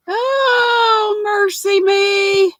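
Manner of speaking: high, drawn-out exclamation of mock dismay; hammed-up southern oh mercy me. Real, not acted. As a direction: throw the voice way up and stretch out mercy me. Reacts to a comment, exclaiming.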